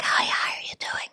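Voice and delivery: whispered greeting, no voice; weak whisper. Retold or imitated. Nurse greeting colleagues after surgery, whispering. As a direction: whisper the hello with a damaged voice. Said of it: "I was speaking very low".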